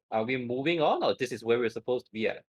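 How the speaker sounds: normal talking voice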